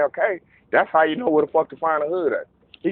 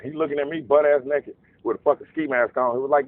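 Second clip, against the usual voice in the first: ordinary talk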